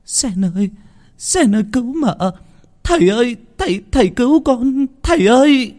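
terrified crying for help. A narrator's reading. Girl being dragged off, screaming to her maid and father for help. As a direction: cry out in panic as she is dragged away. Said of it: "Nguyệt sợ lắm, Nguyệt gào lên".

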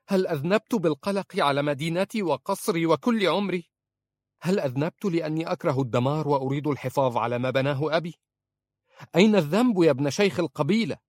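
high, fast, indignant protest; agitated outburst. Acted. Princess lashes out at her husband, high indignant outburst. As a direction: fling every question back at him, rising. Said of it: "قالت في عصبية".

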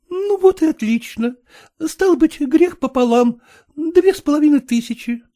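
high, playful scolding; coquettish young woman. A narrator's reading. Agniya coaxing Kutsin, high and playful. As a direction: tease him into giving way.

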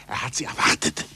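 usual speaking voice

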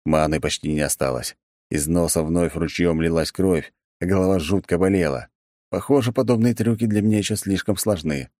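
plain reading voice

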